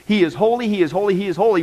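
holy stressed and lifted each time; emphatic repetition. Shown as a demonstration. Preacher drives home holy for emphasis. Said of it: "that is for emphasis".

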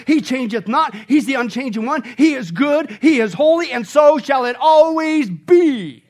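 loud, high, ringing sermon climax; full-force preaching. Acted. Pastor thundering to his congregation about God's unchanging goodness, loud and soaring. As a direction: preach it out to the rafters.

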